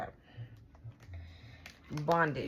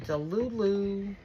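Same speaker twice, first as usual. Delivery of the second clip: slow, stretched syllables on the verdict; drawn-out mockery; slower than usual. Telling bro this person is delulu, slow drawn-out mockery. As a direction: drag the words out in mock disbelief.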